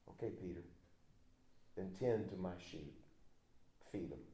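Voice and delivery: calm him down softly; gentle Jesus. Acted. Jesus to Peter, giving the task, soft and low.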